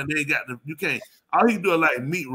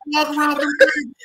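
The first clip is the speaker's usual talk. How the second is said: higher, shouted scolding of his buddy; mock yell; 10 semitones higher, 4 dB louder than usual. Telling buddy off about his toes, mock yell. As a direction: yell it like you can't believe it.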